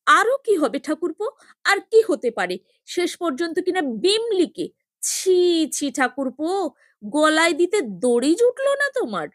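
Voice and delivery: sister-in-law scolds him, high and loud; disgusted scolding. A narrator's reading.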